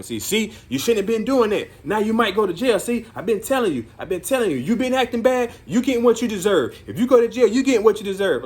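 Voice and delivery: high, loud, nagging I-told-you-so; mock negative scolding. Counselor acting out how he won't scold the kid, loud mock nagging. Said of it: "put this whole negativity thing on him".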